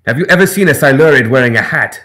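loud, retorting other voice; shot back. Acted. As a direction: snap it back sarcastically. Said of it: "Hakim shot back".

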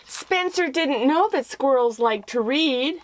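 lively read-aloud storytelling voice; story narration. A narrator's reading. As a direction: tell it like reading to children.

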